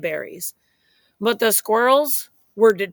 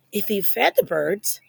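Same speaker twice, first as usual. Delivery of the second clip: ordinary delivery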